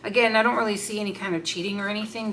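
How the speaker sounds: ordinary talk, nothing marked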